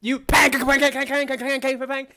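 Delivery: loud, rapid metallic bang-bangs; imitated clanging. Game master voicing noise echoing down the tunnel, clanging. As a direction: rattle out the bangs, loud and high.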